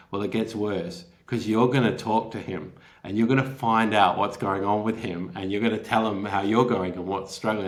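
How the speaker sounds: ordinary talk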